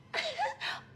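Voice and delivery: high mocking cackle; shrill sneering giggle; gloating laugh. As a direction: laugh at her panic. Rival girl gloats at Feli.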